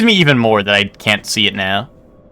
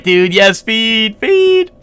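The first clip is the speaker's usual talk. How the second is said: excited cheer; 10 semitones higher, much slower than usual. Retold or imitated. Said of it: "and we were like".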